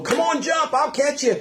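cheerful coaxing daddy. Retold or imitated. Daddy at the water's edge calling his child to jump, high cheerful call.

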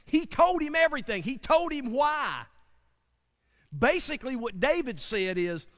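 full-force preaching. Real, not acted. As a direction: drive the point home to the congregation.

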